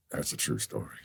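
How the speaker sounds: very low, hushed actor impersonation; quiet, deep mock confession. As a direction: drop it low and soft, imitating the star. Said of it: "and he went".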